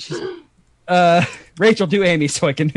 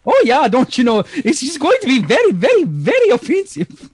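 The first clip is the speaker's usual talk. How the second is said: high, singsong mock Minnesotan; exaggerated swooping Minnesotan lilt; Minnesotan accent; 5 semitones higher than usual. Shown as a demonstration.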